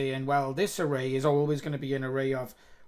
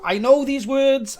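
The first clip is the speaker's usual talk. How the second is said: exasperated; 11 semitones higher, 12 dB louder than usual. Real, not acted. Frustrated exclamation.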